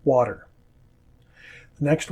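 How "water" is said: Water with the T tapped like a quick D; Water, North American flap in the middle; flapped T. Shown as a demonstration. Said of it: "but not in most of North America".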